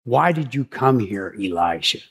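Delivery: low, deep voice of God asking a question. Retold or imitated. God asking Elijah in the cave, low solemn voice. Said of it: "he says the same exact thing to him again".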